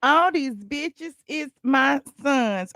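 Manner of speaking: drawn-out, slow line; slowly echoing the intro song. Acted. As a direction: stretch each word out.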